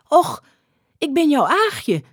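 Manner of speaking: high-pitched plaintive woman's voice; pleading woman. A narrator's reading. Aagje to the skipper who does not know her, high plea.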